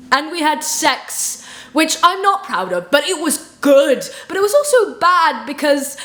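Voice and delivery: high, loud rush of admission; flustered blurting. Acted. Confessing sex to best friend, loud flustered outburst.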